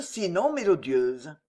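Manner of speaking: ordinary delivery, nothing marked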